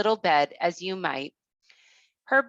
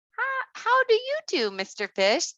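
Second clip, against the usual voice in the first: put-on much higher, sweet small girl; tiny girl greeting politely; little girl voice; 13 semitones higher than usual. Thumbelina greets the fish.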